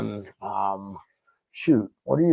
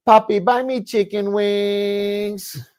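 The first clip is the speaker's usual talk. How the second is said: put-on high, slow, louder demanding girlfriend; mock girlfriend voice; 10 semitones higher, 5 dB louder, much slower than usual. Friend mimics ex calling him puppy, high slow whine. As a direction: whine it slowly like a girlfriend wanting wings.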